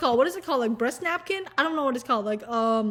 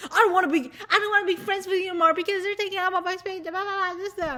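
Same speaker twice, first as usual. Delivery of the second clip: higher, fast whining; mock whiny contestant; 5 semitones higher, faster than usual. Chicks ganging up on the dudes, higher fast mock whining. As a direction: complain like a petty rival. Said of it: "they were all just like".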